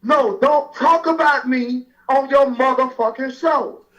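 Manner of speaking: ordinary talk, nothing marked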